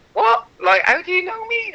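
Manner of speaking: retold inner disbelief, voice shooting up high; amazed disbelief. Athlete amazed a star footballer knows him, in disbelief. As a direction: play stunned confusion, pitch leaping up. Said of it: "looking at him thinking".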